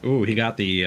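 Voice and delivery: usual speaking voice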